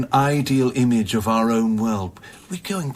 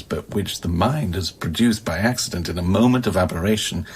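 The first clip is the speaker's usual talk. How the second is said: normal talking voice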